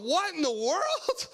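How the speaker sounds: high, slow, baffled cry; bewildered. Retold or imitated. Boy reacting to the voice in his head, high bewildered. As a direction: stretch it out high in disbelief. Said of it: "And I'm going".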